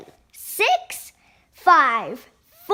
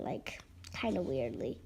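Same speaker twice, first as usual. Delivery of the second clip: whispering; 13 semitones lower, 16 dB quieter than usual. Real, not acted. Aside about zebra on lion.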